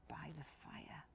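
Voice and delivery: 1 whisper. A narrator's reading. Lulling to sleep.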